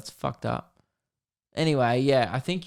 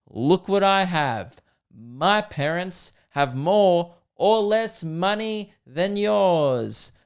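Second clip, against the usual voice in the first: slow, higher boastful schoolkid voice; mock show-off kid; 5 semitones higher, much slower than usual. A kid at show and tell, lightly voiced, bragging slowly to classmates. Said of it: "you bring in your coolest toy and you go".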